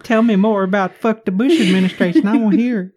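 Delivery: high teasing put-on voice; mocking squeaky voice. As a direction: needle her in a squeaky voice. Teasing the friend's pick.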